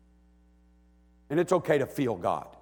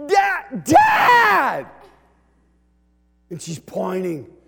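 high loud calling out; excited child call; 10 dB louder, much slower than usual. Retold or imitated. Daughter calling dad from the back seat, high and loud.

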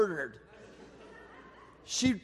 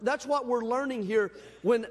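normal talking voice

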